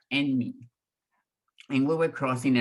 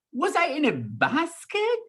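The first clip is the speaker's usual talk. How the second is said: put-on childlike question; 14 semitones higher than usual. Child voice asking brother.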